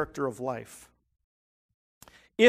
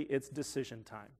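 much softer, low and weighty; hushed and grave; 5 semitones lower, 11 dB quieter than usual. Retold or imitated. Jesus turning to the crowds, hushed and grave. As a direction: drop it down to a serious hush. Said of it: "turns to the crowds and says".

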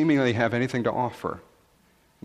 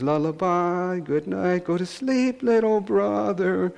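put-on higher little girl, softly singsong; high singsong child voice; child's lullaby voice; 7 semitones higher than usual. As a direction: croon like a small sister. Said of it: "she sang in a very soft voice".

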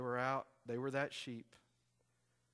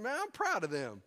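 excited; 8 semitones higher, 7 dB louder than usual. Retold or imitated. Telling wife he's proud.

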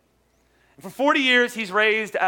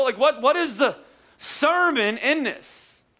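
slow puzzled question; 5 semitones higher, slower than usual. Real, not acted. Pastor asks where the sermon is.

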